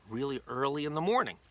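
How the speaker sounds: usual speaking voice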